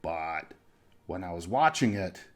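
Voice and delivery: ordinary talk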